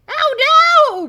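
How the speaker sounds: high, very loud horrified cry; exclaiming. A narrator's reading. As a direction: wail it out in cartoon alarm. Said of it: "Professor Shock exclaims".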